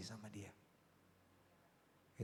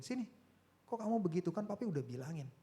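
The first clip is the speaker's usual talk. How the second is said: raised-pitch parental telling-off; mild retold scolding; 8 semitones higher, 3 dB louder than usual. Father calling his child over to discipline him, mild scolding. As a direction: lightly act out a father scolding.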